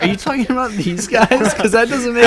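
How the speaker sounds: high, loud incredulous question; baffled. Retold or imitated. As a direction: sound totally confused by the message. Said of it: "I'm like".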